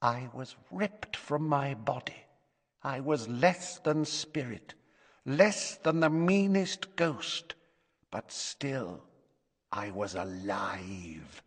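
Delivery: hushed, drawn-out character voice; haunted character recalling torment. Acted. Speaker recalls being ripped from the body, hushed and haunted. Character's quoted recollection in narration.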